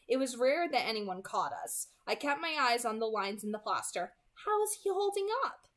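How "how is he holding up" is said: put-on higher, light girlish voice; Alice's voice. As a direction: ask it as a worried sister. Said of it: "she asked me".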